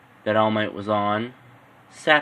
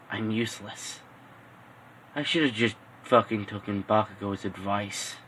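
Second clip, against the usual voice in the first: softer, muttered self-reproach; muttering to himself; 8 dB quieter than usual. Acted. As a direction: say it under your breath, beaten down. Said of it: "saying to himself".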